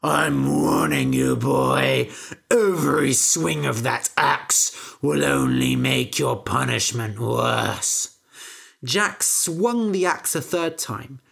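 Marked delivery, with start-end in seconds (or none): 0.0-8.1 menacing giant. Acted. Giant warns Jack from the beanstalk, low menacing. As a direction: threaten slowly like a giant.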